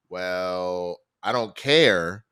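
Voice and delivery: low, drawn-out dismissal; unmoved refusal. Retold or imitated. Librarian refusing a support dog, slowly dismissive. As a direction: imitate a librarian who will not budge.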